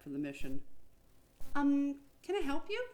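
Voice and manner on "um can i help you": higher, louder timid receptionist voice; politely asking the armed men; Sam asking. A narrator's reading. Receptionist Sam asking the security men, politely. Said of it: "Sam asked the men".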